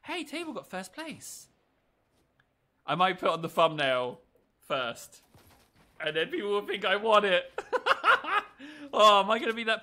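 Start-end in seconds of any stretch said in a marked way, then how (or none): 0.0-1.5 high, drawn-out mock surprise; imagined viewer. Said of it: "they're gonna think". 7.5-8.9 high, loud laugh; mischievous laughter. Real, not acted. Laughing at own thumbnail prank.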